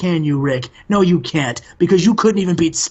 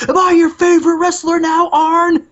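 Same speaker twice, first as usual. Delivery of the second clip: much higher, louder sneering taunt; mocking taunt; 13 semitones higher, 5 dB louder than usual. Alex Wright taunting Arn Anderson, high mocking sneer. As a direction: rub it in Arn's face. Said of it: "looks at Arn Anderson and goes".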